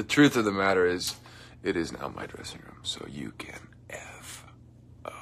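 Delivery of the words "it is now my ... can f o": softer conspiratorial whisper; playful whispered brush-off; mock whisper. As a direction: whisper the cheeky threat. Whispered joke about dressing room.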